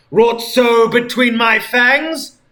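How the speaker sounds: high, loud, slow villain voice; hammed-up fiendish gloat; gloating vampire thought. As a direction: savour the words like a fiend. Sonic's gloating inner thought.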